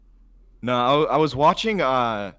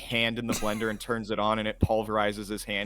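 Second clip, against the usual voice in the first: usual speaking voice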